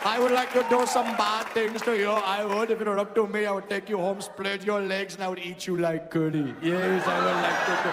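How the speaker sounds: hammed-up Indian accent, lewd flirting; comic Indian-accented seducer. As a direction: talk dirty in a mock Indian accent.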